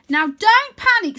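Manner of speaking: put-on high, slow and loud goofy boy; calling down in a big high cartoon voice; bright playful wizard-boy voice; Wyn's voice. A narrator's reading. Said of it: "says Wyn".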